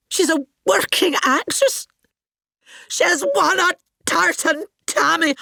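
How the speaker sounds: high, shrill indignation; wounded diva fury; mounting hysterical outrage; outraged disbelief. Acted. Indignant rage at losing award.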